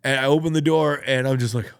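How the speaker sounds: ordinary talk, nothing marked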